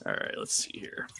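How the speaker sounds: soft whisper to yourself. Real, not acted. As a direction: breathe it under your breath. Whispering to self mid-fight.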